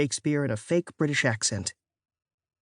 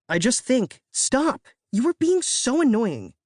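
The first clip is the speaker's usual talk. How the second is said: exasperated; 8 semitones higher than usual. A narrator's reading. Narrator exasperated with Katie.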